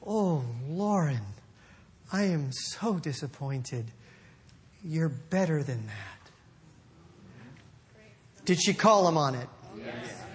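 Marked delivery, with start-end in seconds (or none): 0.1-6.3 soft, slow, lower voice of a kind teacher; sorrowful but loving, slowly; gentle disappointment. Retold or imitated. Teacher gently telling the boy he is better than that. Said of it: "He goes".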